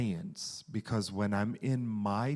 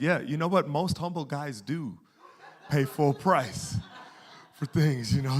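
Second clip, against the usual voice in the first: sarcastic; 4 semitones higher, 5 dB louder than usual. Retold or imitated. Sarcastic reply to boss.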